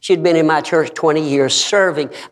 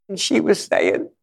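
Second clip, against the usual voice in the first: usual speaking voice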